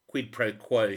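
a sudden loud bargaining demand; loud quid pro quo. A narrator's reading. Woman pressing a reporter to trade information, loud and firm. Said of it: "she said".

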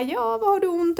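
high, sweet voice of a friendly masseuse; friendly therapist. Retold or imitated. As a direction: chirp the question like a kind nurse. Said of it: "hon bara".

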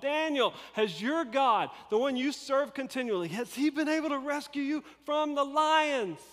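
a high, loud, anxious call into the den. Retold or imitated. King calls into the lion's den, high and anxious. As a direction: call out to Daniel, hoping against hope. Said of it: "he's calling out".

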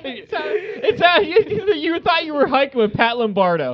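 laughing high through the words. Real, not acted. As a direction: crack up while speaking.

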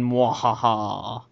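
low, slow sneering panto villain laugh; evil villain cackle. Pretend. Halloween intro, host plays spooky.

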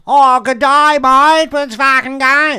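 loud, high, drawn-out Australian accent; over-the-top Australian accent. Shown as a demonstration. Comedian showing how we go too big, Australian accent. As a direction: bellow a broad Australian caricature. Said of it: "So Australians, you go".

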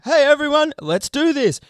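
mock showman greeting; stage hype. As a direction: pump up a big crowd from the stage. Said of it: "and be like".

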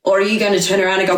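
usual speaking voice

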